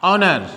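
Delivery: "honor" with the H left silent. Shown as a demonstration. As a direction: start "honor" straight on the vowel, no H.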